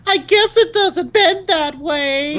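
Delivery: very high, slow cartoonish boss voice; hammed-up high-pitched robot impression. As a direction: squeak it out slowly like an annoying game boss. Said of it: "Absolutely love X T".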